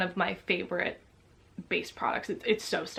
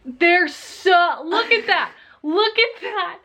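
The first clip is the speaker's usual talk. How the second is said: high, loud disbelief; shocked; 9 semitones higher, 4 dB louder, much slower than usual. Real, not acted. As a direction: point it out aghast. Shock at same shade.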